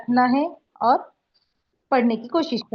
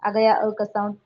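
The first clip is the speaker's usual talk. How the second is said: normal talking voice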